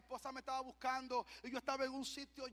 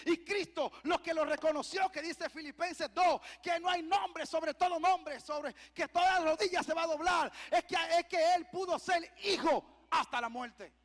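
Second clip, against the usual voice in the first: loud, high, climactic proclamation; full-force preaching; 5 semitones higher, 8 dB louder than usual. Acted. Preacher exalting Christ as obedient Son, full-force shout. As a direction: drive the sermon to a shouted peak.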